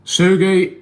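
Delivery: loud, high call to Sergey. Real, not acted. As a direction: sing out the name to greet him. Greeting a viewer by name.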